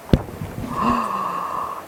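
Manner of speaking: soft unvoiced gasp of dismay; breathy fearful gasp. Boy finding the hole gone, a soft shocked gasp.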